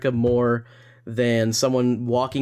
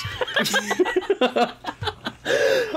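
high giggling fit; high laugh. Real, not acted. Laughs at meowing.

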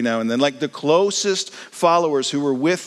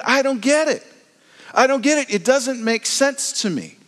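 bewildered outburst; 11 semitones higher than usual. Pretend. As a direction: throw up your hands in confusion. Disciple's confused outburst.

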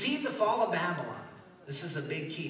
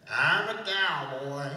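loud, slow country singer parody; country cowboy voice; 6 dB louder, much slower than usual. Pretend. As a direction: belt it out in a drawn-out country drawl. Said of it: "That's my country voice".

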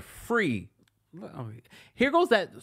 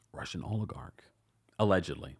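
a conspiratorial whispered aside; 6 semitones lower, 6 dB quieter than usual. Real, not acted. Whispering a cheeky aside to viewers. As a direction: drop to a secret whisper. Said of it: "whispered aside".

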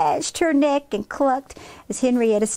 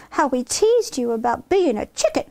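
ordinary delivery, nothing marked

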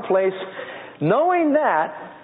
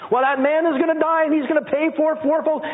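much higher, indignant mock outrage; outraged king; 12 semitones higher than usual. Preacher voicing outraged King David, high and indignant. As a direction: voice David's furious verdict.

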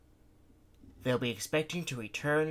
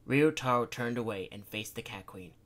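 plain reading voice

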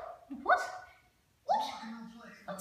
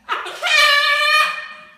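loud burst of laughter; 12 semitones higher, 12 dB louder than usual. Real, not acted.

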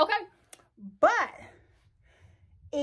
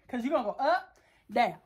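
ordinary delivery

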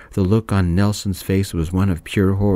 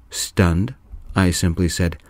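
usual speaking voice

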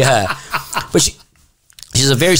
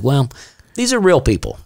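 higher, matter-of-fact comeback; deadpan retort; 5 semitones higher than usual. Retold or imitated. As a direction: set them straight, dry. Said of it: "And I'm like".

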